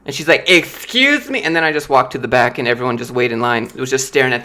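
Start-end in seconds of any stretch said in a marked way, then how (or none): 0.5-1.4 much higher indignant excuse me; offended lady. Retold or imitated. Rude customer offended by the worker, very high. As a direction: squeak it out, outraged.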